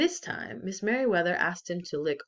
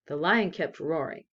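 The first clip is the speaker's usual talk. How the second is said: ordinary delivery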